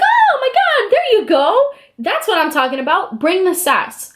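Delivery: very high squeal easing down to a pleased cheer; high, gushing imitation; excited approval. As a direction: mimic a delighted judge egging someone on. Imitating judge's delighted approval.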